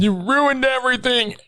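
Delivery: hammed-up high accusation; shrill villain. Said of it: "echoing villain line".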